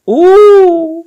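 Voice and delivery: ऊ stretched long and high; ऊ को लंबा खींचो, ऊँचे सुर में. Shown as a demonstration. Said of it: "जैसे होता ना". (ऊ लंबा और ऊँचा)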